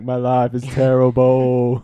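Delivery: mock miserable Jawa. Voicing the Jawa fanning Jabba, slow mock moan. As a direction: drag it out like a bored servant.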